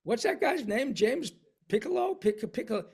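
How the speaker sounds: high, excited gushing fan; impressed fan. Retold or imitated. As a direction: gush like an amazed audience member. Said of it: "when people go".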